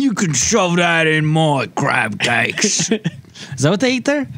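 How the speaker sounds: high, slow hammed-up furious English yell; mad British shout. Podcast host ranting as a furious Brit, hammed-up shout. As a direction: rage like a cartoon angry Brit. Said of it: "I'm British and I'm mad".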